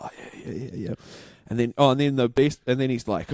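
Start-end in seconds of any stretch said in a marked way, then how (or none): 0.0-0.9 very soft, almost unvoiced run of yeahs; imitated meek agreement. Trainee doctor agrees while being shown the machine, imitated very quietly. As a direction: murmur along obediently as the trainee.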